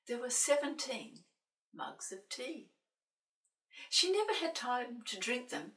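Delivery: ordinary delivery, nothing marked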